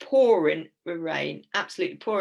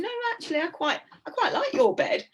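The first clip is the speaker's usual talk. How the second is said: much higher, laughing mock Julie; imitating Julie. Julie refusing to move to the tent, high giggly imitation. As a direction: squeak it out like a giggly friend. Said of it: "But Julie's going".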